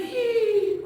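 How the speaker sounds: sharp, high gasp; theatrical intake of breath; shocked gasp. Acted. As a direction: gasp in mock shock for young listeners. Teacher reacts to book picture.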